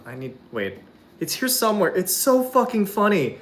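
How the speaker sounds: ordinary delivery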